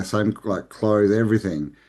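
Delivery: normal talking voice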